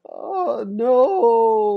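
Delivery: high, wailing oh no; whimpering like a cursed brat; mock whiny groan. Mocking Chet wailing at Lisa, high and whiny.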